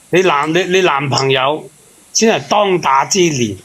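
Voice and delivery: ordinary delivery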